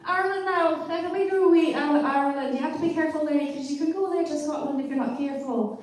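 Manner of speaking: a playful Irish lilt, warned off drifting toward Scottish; Irish accent. Shown as a demonstration. A comedian trying the Irish accent on request, sounding lightly Irish. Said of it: "Can you do, uh, Irish?".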